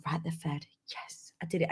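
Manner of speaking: a spent mum murmuring a small victory under her breath; weary self-talk. Retold or imitated. Exhausted mum to herself after feeding kids, whispered.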